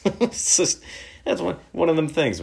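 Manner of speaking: high, loud laugh through the words; helpless chuckle pushing the voice up high. Real, not acted. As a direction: talk through a real laugh. Laughs at his own story.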